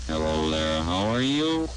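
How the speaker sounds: robotic glide rising from very low; sliding vocoder pitch. Shown as a demonstration. Demonstrator greets while turning the dial, vocoder pitch rising. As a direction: swoop steadily upward like a machine.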